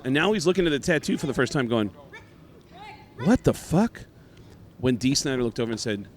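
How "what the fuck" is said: higher, louder shocked outburst; mock shock. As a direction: act out the drummer's disbelief. Drummer sees tattoo.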